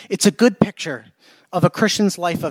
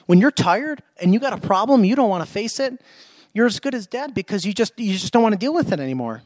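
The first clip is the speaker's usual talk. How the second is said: ordinary talk, nothing marked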